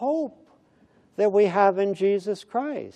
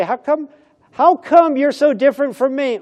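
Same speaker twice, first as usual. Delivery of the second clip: higher and louder, genuinely astonished; amazed question; 9 semitones higher, 7 dB louder than usual. Retold or imitated. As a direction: ask it as if you can't believe it. Neighbor asks amazed question.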